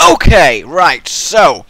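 ordinary delivery

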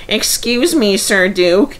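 high, eager interruption; bursting out. Acted. Peter Sam bursts out at the Duke, high and eager. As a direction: blurt it out, unable to hold back. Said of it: "he burst out".

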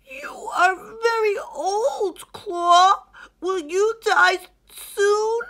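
high, loud, tearful hippo voice; anxious young hippo. Acted. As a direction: ask a frightening question. Hilmi asks the lion.

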